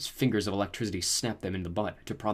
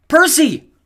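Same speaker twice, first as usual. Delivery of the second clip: very high, loud cry of his name; alarmed yell; warning shout; 16 dB louder than usual. A narrator's reading. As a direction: warn him of the teeth. Annabeth warns again.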